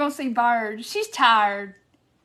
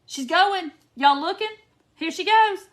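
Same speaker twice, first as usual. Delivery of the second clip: building higher and louder with excitement; excited anticipation; 7 semitones higher, 5 dB louder than usual. Real, not acted. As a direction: get everyone watching before it happens. Calling viewers to watch the topple.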